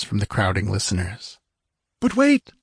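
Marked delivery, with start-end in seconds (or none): 2.0-2.5 high, eager interjection; urgent high-pitched cry to wait; exclaiming. Acted. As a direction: cut in excitedly in a high voice. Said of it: "exclaimed Techotlь".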